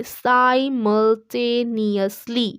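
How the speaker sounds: simultaneously said very slowly as sai-mul-te-ni-us-li; slow pronunciation demo. Shown as a demonstration. Teacher showing students how to read simultaneously, very slowly. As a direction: break simultaneously into slow clear syllables. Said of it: "आप हिंदी में पढ़िए".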